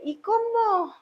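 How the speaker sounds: put-on high, softened little-kid question; child's voice. Retelling her son questioning the doctor, childlike. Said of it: "mi hijo le dice".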